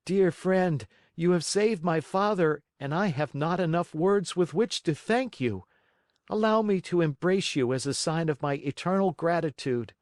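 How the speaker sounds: put-on high, earnest little boy; grateful puppet boy. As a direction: pour out heartfelt thanks. Pinocchio thanks the Tunny.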